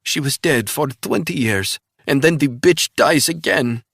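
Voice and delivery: put-on high, sneering Russian accent; mock him in a Russian accent; Russian spy taunting in Russian accent. A narrator's reading. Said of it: "The accent turned Russian".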